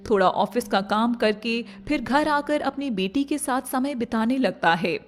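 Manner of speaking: plain reading voice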